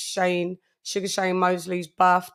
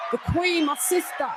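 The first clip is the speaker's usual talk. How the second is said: hype shout-out; 4 semitones higher than usual. Real, not acted. Hyped roll call shout.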